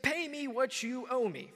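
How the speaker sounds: a creditor grabbing a throat; angry demand. Acted. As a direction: demand the money with menace. Servant choking his debtor.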